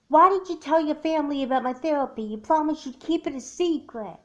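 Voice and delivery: fast, loud and high, hurt and furious; confronting a friend who broke her trust, hot and fast; angry accusation. Acted. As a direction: throw the betrayal right back at her. Friend angry about a broken secret.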